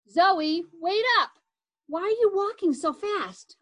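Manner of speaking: high, loud call to wait. A narrator's reading. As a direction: shout after her, out of breath. Arcade running after sister.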